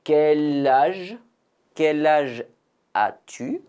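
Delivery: "Quel âge" linked, the L sliding into "âge"; liaison shown. Shown as a demonstration. Teacher models the liaison for learners, slow and clear. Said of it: "N'oubliez pas de faire la liaison ici".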